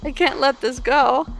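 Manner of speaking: high, urgent character voice; urgent pleading. Acted. Pleading with the intruder-blocking slug cat.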